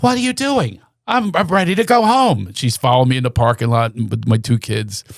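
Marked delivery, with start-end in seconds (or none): 0.0-2.5 high, fretful mom voice; impatient mother. Retold or imitated. Son imitating mother in the parking lot. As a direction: demand to go home. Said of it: "And she goes".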